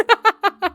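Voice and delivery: high, loud laughing burst; real high-pitched laughter. Real, not acted. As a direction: crack up after the line. Laughs at the story.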